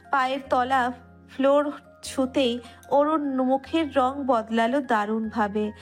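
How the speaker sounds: usual speaking voice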